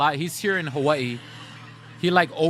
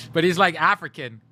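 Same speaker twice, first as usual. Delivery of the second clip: ordinary talk, nothing marked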